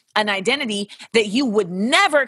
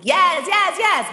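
much higher mock eager yeses; 10 semitones higher, 3 dB louder, slower than usual. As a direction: imitate dudes drooling with excitement. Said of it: "they may wanna be like".

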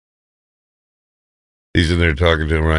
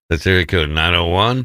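ordinary talk, nothing marked

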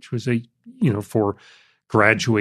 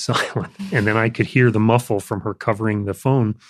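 ordinary delivery

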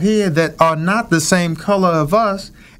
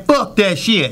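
a hint of a gruff Cleveland accent on "fuck that shit"; lightly imitated Cleveland guy, "fuck that shit" at a near-usual pitch; thick Cleveland accent; 3 semitones higher than usual. Retelling what the Cleveland man said, a light thick Cleveland accent. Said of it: "in his thickest accent is saying".

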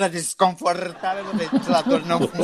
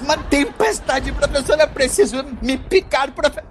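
panicked; 9 semitones higher than usual. Acted. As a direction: cry out as the storm hits. Sudden storm, panic, raised pitch.

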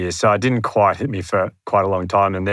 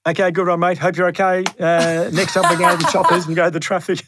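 much higher, quick radio host sign-off; breezy radio host; 9 semitones higher than usual. Retold or imitated. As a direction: rush to the traffic like a chirpy DJ. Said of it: "They're like".

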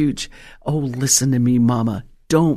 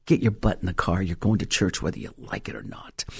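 put-on soft, sugary threat to a teenager; mother sweetly ordering teen to church; sweet but firm mother; 7 semitones lower, 6 dB quieter than usual. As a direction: say it real nice, with a smile. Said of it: "real nice and smile and say".

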